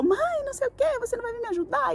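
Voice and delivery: mock the upset daughter calling for mum, high-pitched; angry daughter's complaint. Mother imitates her daughter yelling about the lost cat, in a high mock whine. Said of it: "escutei a Laura gritando, é, brava".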